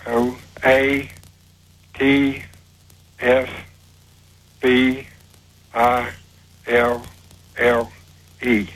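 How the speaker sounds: letter by letter, long pause after each; each letter set apart and evenly spaced; slow spelling. Real, not acted. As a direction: spell it out slowly, one letter at a time. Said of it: "do it again slow, slowly, please".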